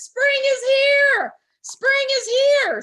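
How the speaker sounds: very high, loud, slow yell. A narrator's reading. Groundhog yelling through the woods, very loud. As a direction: holler it across the woods. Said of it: "He yelled through the woods".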